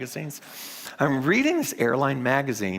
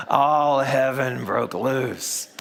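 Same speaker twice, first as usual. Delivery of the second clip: All heaven broke loose, slower and louder, falling at the end; repeated punchline; 3 semitones higher, 5 dB louder, slower than usual. Shown as a demonstration. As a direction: hammer the pun home. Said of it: "Do you see what I just did there?".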